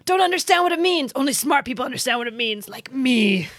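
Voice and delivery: high-pitched pompous know-it-all voice; mock smug German. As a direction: ham up a self-satisfied intellectual. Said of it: "the Germans are like".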